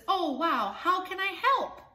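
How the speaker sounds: high, loud and eager; eager boy. Acted. Boy at the store offering to help his tired mom, high and eager. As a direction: jump in keen to help.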